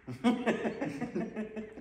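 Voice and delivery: bursting out laughing. Real, not acted. Laughs at own joke.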